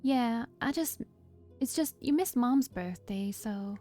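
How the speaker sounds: attempted country accent. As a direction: raise the voice into a hesitant country twang. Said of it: "country accent that I was trying to do".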